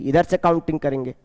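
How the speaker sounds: ordinary talk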